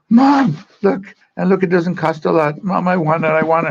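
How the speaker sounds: put-on high, loud little kid begging; pleading child. Boy begging his mom for the red bike, high and pleading.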